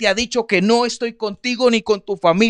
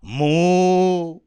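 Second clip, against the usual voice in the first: cow moo. Acted. A cow lowing in the background of the story, a drawn-out moo. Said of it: "una vaca muge".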